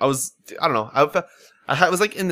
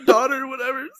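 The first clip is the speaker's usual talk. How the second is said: high wailing sob-voiced rant at the game; mock crying rant; 11 semitones higher than usual. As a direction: whine it out like you're bawling over the story. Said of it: "I was like".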